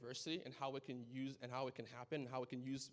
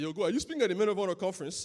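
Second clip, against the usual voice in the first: higher, much louder and fast, calling out; excited dad; 7 semitones higher, 15 dB louder, faster than usual. Retold or imitated. Dad calling his son about the conference, loud and excited. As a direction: play the dad bursting with excitement on the phone. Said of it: "he's like".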